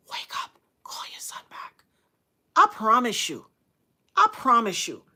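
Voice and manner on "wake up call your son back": soft whispered command; whispered divine voice. Retold or imitated. The Holy Spirit waking the mother, whispered. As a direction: whisper it like a voice in a dream. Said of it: "the Holy Spirit said".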